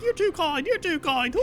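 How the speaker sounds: very high mock-female British voice; gushing British women. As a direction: imitate flattered British ladies in falsetto. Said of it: "like, three women".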